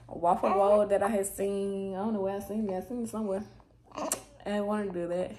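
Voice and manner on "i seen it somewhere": ordinary talk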